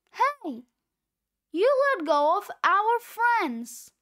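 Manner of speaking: ordinary delivery, nothing marked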